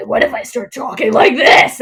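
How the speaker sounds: mock the actor's changed voice, half-hearted; mocking Littlefinger's new voice. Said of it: "he changed his voice in the middle".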